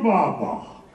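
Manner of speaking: usual speaking voice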